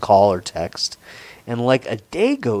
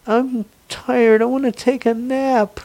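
put-on much higher, childlike whine; childish voice; 12 semitones higher than usual. Imitating the girl sounding like a child, high and whiny. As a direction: play a sleepy little kid. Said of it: "Was she three?".

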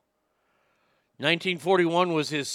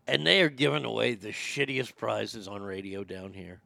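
usual speaking voice

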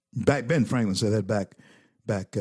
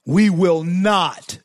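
high, loud, slow defiant cry; fist-in-the-air slogan shout; 6 semitones higher, 7 dB louder, slower than usual. Real, not acted. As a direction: bellow it like a rally chant.

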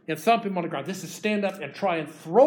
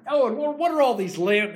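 high and louder, tumbling out in wonder; amazed exclamation; 7 semitones higher, 6 dB louder than usual. Acted. Esau marvelling at Jacob's flocks, higher and louder. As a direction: blurt it out in delighted surprise.

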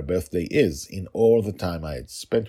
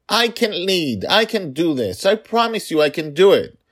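put-on much higher, loud pleading child; whiny little girl; 13 semitones higher, 8 dB louder than usual. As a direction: beg like a small girl sure she can. Father imitating daughter's pleading.

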